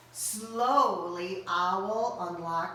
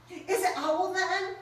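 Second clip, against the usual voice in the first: nervous; 10 semitones higher than usual. Acted. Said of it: "Rabbit gulped".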